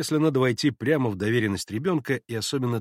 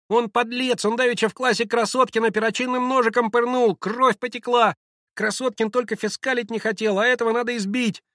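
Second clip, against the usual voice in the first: put-on high-pitched boy's complaint; angry child accusing; 11 semitones higher, 4 dB louder than usual. A narrator's reading. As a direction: blurt charges in a child's voice. Тот же мальчик обвиняет. (возмущённый детский голосок)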